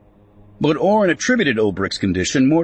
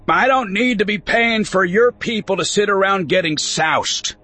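put-on higher gravelly voice of a grumpy old farmer; grumpy old man voice; 11 semitones higher than usual. A narrator's reading. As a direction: scoff like a cranky old rancher. Grumpy old farmer Ulbrich speaks.

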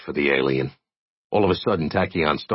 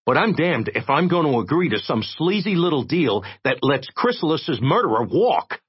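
higher, defiant refusal; defiant young man; 9 semitones higher than usual. Acted. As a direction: stand your ground. Jay refuses the deal.

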